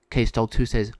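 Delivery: plain reading voice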